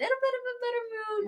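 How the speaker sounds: high, drawn-out final word; singsong it, holding the last word high; lilting up into a long high note; playful singsong. Real, not acted. Playfully admitting her improved mood.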